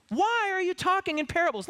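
high, pleading, bewildered voice; high exasperated plea to Jesus; exasperated disciples. Acted. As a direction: voice the baffled disciples begging Jesus. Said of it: "you almost hear them in the subtext saying".

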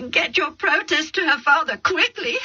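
a distraught mother begging through sobs; sobbing plea. Acted. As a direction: beg through tears. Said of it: "Rebecca sobbed".

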